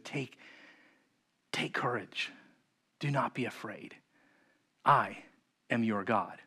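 very soft, hushed reassurance; tender and low-key, almost under the breath; calm, kind voice. Acted. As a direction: offer comfort quietly, leaving long pauses. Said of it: "He says in a calm, kind voice".

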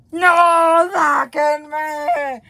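high, drawn-out wail to get out; long, high cry of despair; desperate shout. Acted. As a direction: scream to be let out. Begging to leave the cell.

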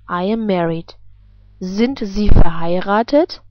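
usual speaking voice

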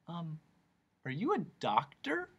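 soft, hesitant question; timid Milo. A narrator's reading. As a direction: ask softly and uncertainly. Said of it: "trying to feel as well as possible".

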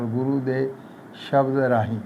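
ordinary talk, nothing marked

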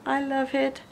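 ordinary talk

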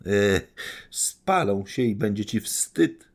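high, loud mocking cry; shouting. Anastazja shouting at Gania over the burning money, loud and high. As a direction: hurl it at him as a taunt. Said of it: "krzyczała Anastazja Filipowna".

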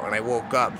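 ordinary delivery, nothing marked

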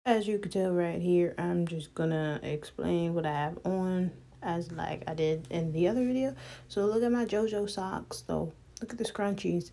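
ordinary talk, nothing marked

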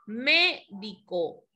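"médico" slow, drawn out on "mé"; acento esdrújulo. Shown as a demonstration. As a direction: stretch "médico" slowly, leaning on its first syllable. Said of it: "llevan tilde siempre". («médico» lento, alargando la primera sílaba)

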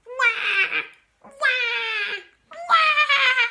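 high, loud mock baby cries; piercing drawn-out acted baby bawl; bawling baby wail. A narrator's reading. Shrunken Grandma Josephine on the bed, bawling wail. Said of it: "bawling her head off".

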